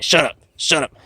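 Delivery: high, loud snapped shout; mock shout. Alien cuts off Bush's begging with a high loud shout. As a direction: bark it to cut him off. Alien snaps at pleading Bush.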